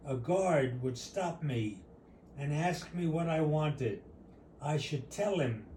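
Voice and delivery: usual speaking voice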